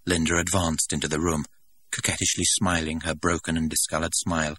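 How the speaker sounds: plain reading voice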